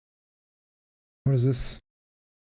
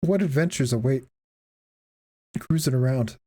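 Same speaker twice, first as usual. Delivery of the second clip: ordinary talk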